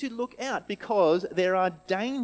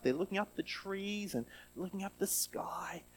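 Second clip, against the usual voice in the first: whisper; 4 semitones higher, 8 dB quieter than usual. Acted. Hushed storytelling moment.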